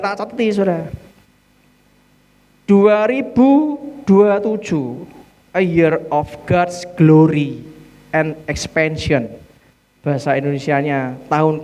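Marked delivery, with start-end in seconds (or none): 2.7-9.3 slow, deliberate proclaiming; slow proclamation. Real, not acted. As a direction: announce the theme word by word. Announcing the year's theme.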